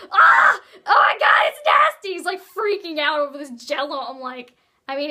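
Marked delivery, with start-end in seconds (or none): 0.1-2.1 high, loud shriek of disgust; disgusted scream. Retold or imitated. Jermaine tasting the yellow Jell-O, disgusted yell. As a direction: recoil and yell at the taste.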